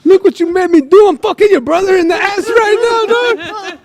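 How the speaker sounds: lightly voiced tough guy taunting; Loco voice. Retelling Loco's phone call to the brother, mock gangster taunt. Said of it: "I was like".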